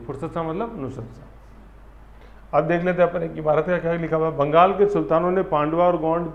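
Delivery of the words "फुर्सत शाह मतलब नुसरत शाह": very slow, much softer linking of the two names; soft slow teacher recap; slow mnemonic recap. Shown as a demonstration. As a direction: ease it out softly, very slowly. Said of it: "mnemonic link spelled out".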